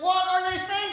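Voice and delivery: high, baffled inner question; puzzled thought. Retold or imitated. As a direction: wonder what on earth they said. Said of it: "you're like".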